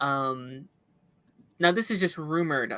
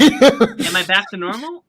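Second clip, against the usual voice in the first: radio voice; 3 semitones higher, 10 dB louder than usual. Real, not acted.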